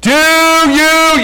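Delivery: very high, loud mock shout; imitating shouting at someone who can't understand. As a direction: bellow it word by word. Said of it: "I'd get louder".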